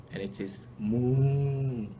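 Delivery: slow, loud drawn-out moo; imitated moo. Shown as a demonstration. Sharing Ghana's cow sound, slow and loud. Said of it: "the sound that a cow makes".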